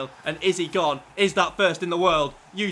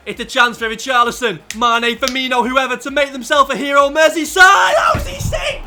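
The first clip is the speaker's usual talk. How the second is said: loud, rising TV commentator calling the final minutes; live match commentary; 7 semitones higher, 9 dB louder than usual. Pretend. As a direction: call it like a cup final on telly. Mock football commentary, big match.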